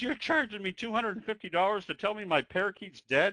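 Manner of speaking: a customer who can't believe the price, lightly played; outraged disbelief. Pet owner balks at the vet's fee, in high mock outrage. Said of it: "She says".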